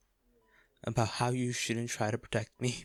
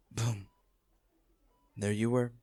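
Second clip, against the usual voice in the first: ordinary delivery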